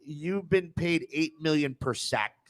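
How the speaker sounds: ordinary talk, nothing marked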